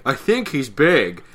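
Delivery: dopey character voice. Acted. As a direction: announce the obvious slowly and loudly. Acting out the dumb line.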